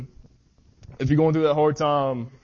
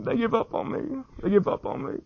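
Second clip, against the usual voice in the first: imitated sobbing; 8 semitones higher than usual. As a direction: let the words crack into a sob. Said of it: "he's bawling and saying".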